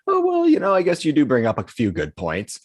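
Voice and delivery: starts very high and loud, gliding down; hammed-up high-voiced concession; mock easily swayed. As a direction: imitate your old self caving in politely. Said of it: "swayed by others in the way that they spoke".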